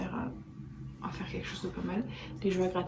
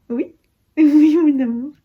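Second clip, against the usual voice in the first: high, very loud delighted coo; laughing coo; 8 semitones higher, 19 dB louder, slower than usual. Real, not acted. Cooing, laughing to baby.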